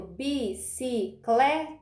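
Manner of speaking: "bicicleta" in four slow beats, bi - ci - cle - ta; separação em sílabas. Shown as a demonstration. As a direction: separate each syllable of "bicicleta". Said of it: "Tem quatro?". ("bicicleta" devagar, sílaba por sílaba)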